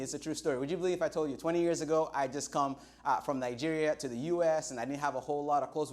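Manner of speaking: ordinary talk, nothing marked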